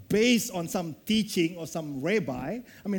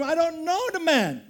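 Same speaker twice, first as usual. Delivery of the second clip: swearing denial; 8 semitones higher, 3 dB louder than usual. Acted. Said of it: "He swore them".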